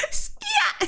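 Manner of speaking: very high squawked ad-lib; mock rap squawk. Londoner repeating a rapper's noise, high laughing squawk. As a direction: squeal it through laughter.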